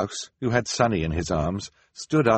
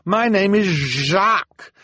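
high, slow, desperate voice; pleading prisoner; 13 semitones higher, 6 dB louder, slower than usual. A narrator's reading. Jacques pleading with the council, desperate and high. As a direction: plead before being cut off. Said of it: "the man cried".

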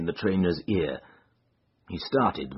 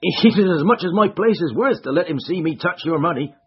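raised, higher rough servant voice; gruff stable lad; 12 semitones higher, 8 dB louder than usual. Acted. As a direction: bark like a wary stable hand.

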